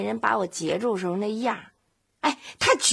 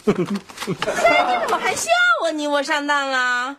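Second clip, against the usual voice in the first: high, drawn-out wailing protest; indignant whine; 4 semitones higher, slower than usual. Acted. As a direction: wail at the one who laughs at you. Wife scolds husband for laughing. (又委屈又生气地拖长声嚷)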